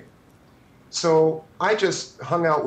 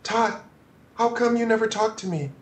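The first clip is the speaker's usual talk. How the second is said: lightly voiced high plea, a bit faster at the end; imitating Michael; 7 semitones higher than usual. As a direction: echo Michael's shy, hurt question. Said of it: "and he goes".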